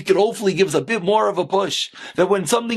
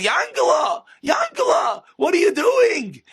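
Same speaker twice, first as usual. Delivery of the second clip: put-on high, screechy old-lady impersonation; comic old lady calling, very high pitched; little old lady; 11 semitones higher, slower than usual. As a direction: call out like a scolding old wife. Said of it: "a little old lady come down and says".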